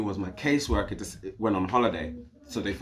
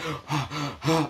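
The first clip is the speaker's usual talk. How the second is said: imitated gasp; 6 semitones higher than usual. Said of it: "She's like".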